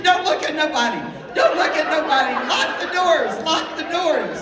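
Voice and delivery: loud, high, frantic repeated commands; panicked orders. Retold or imitated. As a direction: holler orders as a terrified mother, hammed up. Retold mom's panicked orders in the car.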